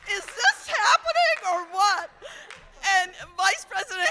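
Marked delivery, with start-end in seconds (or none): 0.1-2.1 higher, louder astonished cry; can't believe it's real; stunned disbelief. Retold or imitated. Daughter astonished at her mom's phone call with the president, higher and louder.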